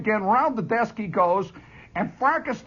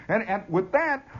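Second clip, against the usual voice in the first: usual speaking voice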